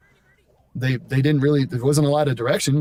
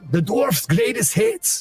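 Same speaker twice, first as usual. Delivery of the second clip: higher, slow cartoon dwarf voice; goofy high dwarf; 8 semitones higher, slower than usual. Shown as a demonstration. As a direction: squeak it out like a little dwarf. Mock character voice.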